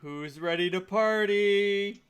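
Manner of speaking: party host hyping the crowd; drawn-out party call; rowdy party animal. Acted. Kicks off the party.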